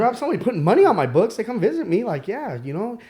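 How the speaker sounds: high, loud and fast; eager to prove someone cares; eager insistence. Retold or imitated. To the guard asking if anyone cares, eager.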